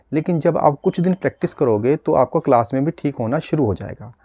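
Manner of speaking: usual speaking voice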